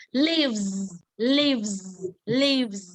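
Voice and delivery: lives drilled with a voiced v ending. Shown as a demonstration. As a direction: repeat lives with buzzing v. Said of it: "chúng ta sẽ đọc là v".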